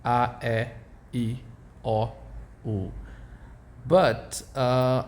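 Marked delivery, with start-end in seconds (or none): 0.1-2.9 A E I O U said the Portuguese way, ah eh ee oh oo; name the vowels as a Brazilian would. Shown as a demonstration. The teacher names the vowels in Portuguese. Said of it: "Those are the names in Portuguese".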